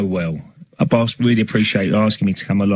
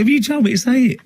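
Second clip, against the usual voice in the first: indignant high retort; 12 semitones higher than usual. Retold or imitated. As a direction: protest in a squeaky incredulous voice.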